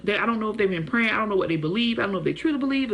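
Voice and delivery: ordinary talk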